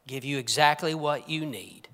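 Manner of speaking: usual speaking voice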